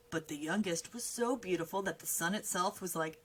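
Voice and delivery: plain reading voice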